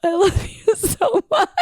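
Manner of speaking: slow, high, laughing love; slow laughing declaration; laughing gratitude. Real, not acted. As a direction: gush through laughter.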